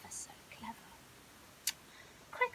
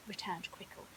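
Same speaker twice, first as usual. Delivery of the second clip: usual speaking voice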